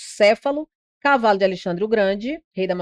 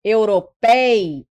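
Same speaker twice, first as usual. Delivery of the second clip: europeias slowly as euro-PEI-as; stressed syllable drawn out; 3 dB louder, much slower than usual. Shown as a demonstration. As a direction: stretch pei, then a separate as in europeias. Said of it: "Paroxítona".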